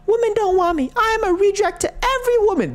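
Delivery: very high mocking whine; high falsetto mockery of self-pity; mock whiny voice. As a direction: squeak it like a pitiful loser. Mocking the guy's old video.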